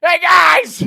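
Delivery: high, loud excited yell; yelling too loud. Retold or imitated. Yelling to his friends in a packed bar, way too loud. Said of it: "I'm talking super loud".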